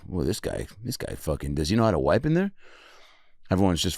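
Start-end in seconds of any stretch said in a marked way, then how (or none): 0.1-2.5 low, soft snide gossip; mock passengers whispering about him. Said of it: "all of first class is like".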